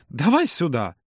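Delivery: slow, insistent request. A narrator's reading. Юра ends his warning about the cut finger, gently insistent. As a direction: draw it out to close the argument. Insists after cautionary tale.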